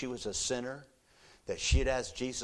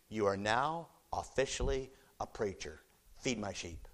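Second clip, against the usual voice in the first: ordinary delivery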